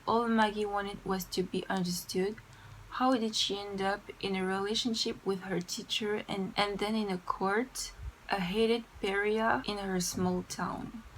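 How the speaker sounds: slow, careful reading in French-accented English; deliberate English, French accent throughout. Real, not acted. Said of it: "je déteste mon accent anglais".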